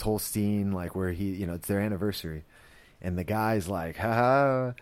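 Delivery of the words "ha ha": higher, louder acted "ha ha"; mock jovial laugh. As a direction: imitate a guy laughing at his own joke. Retold guy's jokey laugh.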